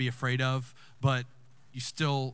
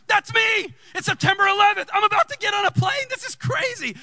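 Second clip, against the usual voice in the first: high, loud comic freak-out; shouted falsetto disbelief, played for laughs; hammed-up shock; 8 dB louder than usual. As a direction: squeal it out in mock alarm. Reenacts shock at the book.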